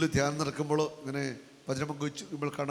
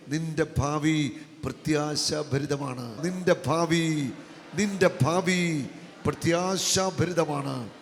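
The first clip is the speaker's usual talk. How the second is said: ordinary talk, nothing marked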